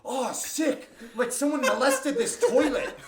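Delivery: loud, high, laughing disgust; recoiling from a wrecked toilet stall; disgusted yell. Retold or imitated. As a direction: shout it grossed out. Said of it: "I'm like".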